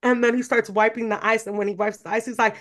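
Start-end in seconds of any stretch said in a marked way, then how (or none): none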